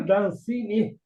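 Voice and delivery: coughing fits mid-sentence; choked up, coughing through words; choking. Real, not acted. (engasgada, tossindo no meio da frase)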